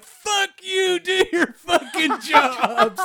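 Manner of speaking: high-pitched, drawn-out furious yell, hammed up; re-enacted enraged scream. Yelling at construction workers from the car window, hammed-up enraged scream. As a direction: scream it out of the car, stretching the last words. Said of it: "I'm just screaming out of my gourd".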